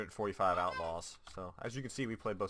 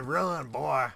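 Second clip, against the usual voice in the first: loud, higher hammed-up Southern drawl; southern accent; 6 semitones higher, 11 dB louder than usual. Retelling the enemy's local chat taunt, Southern accent. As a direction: ham up a creepy Southern yokel. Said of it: "I just imagined a deep southern accent with that".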